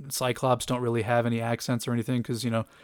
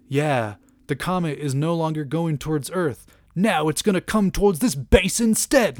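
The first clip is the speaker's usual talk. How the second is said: hammed-up British accent, building in pitch; weird British accent; 3 semitones higher than usual. Kitty confronting Magneto, in an exaggerated British accent. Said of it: "breaks into this weird, like, British accent".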